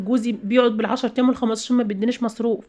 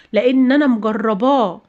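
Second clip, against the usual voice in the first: ordinary talk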